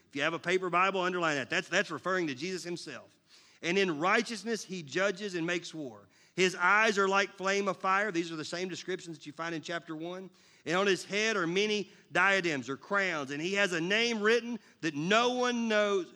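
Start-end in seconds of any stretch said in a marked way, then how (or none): 3.6-8.1 a measured, proclaiming Bible read; scripture reading. Acted. Pastor reads Revelation to the congregation, proclaiming. 10.7-16.1 a measured, proclaiming Bible read; scripture reading. Acted. Pastor reads Revelation to the congregation, proclaiming.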